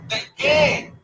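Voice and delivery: put-on high, puzzled little girl voice; a child baffled at being crowned; incredulous girl. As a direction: squeak it out in disbelief. Surprised girl questions.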